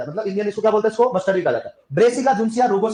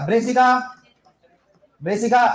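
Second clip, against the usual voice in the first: ordinary talk, nothing marked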